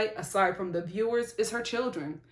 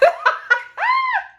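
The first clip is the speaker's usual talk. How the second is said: loud, high burst of laughter; real high-pitched laugh; 8 dB louder than usual. Real, not acted. Reviewer laughing at ending on a sad note, amused.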